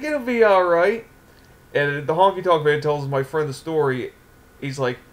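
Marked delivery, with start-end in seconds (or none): 0.0-1.1 higher, glib reassurance; sleazy wrestling promoter. Acted. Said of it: "fast-talking wrestling promoter sleaze bag".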